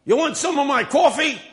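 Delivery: loud high hail of a street man; yelling homeless man. Retold or imitated. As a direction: call out across the sidewalk. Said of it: "And he yelled".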